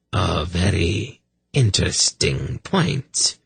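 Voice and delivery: ordinary delivery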